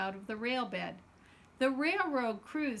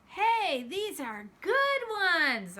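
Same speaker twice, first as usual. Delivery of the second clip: loud, much higher shout, slowing on good ones; excited shout; 8 semitones higher, 5 dB louder, much slower than usual. Acted. Abu shouting to his brother about the railroad ties. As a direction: shout it with delight at the find. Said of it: "Abu shouted".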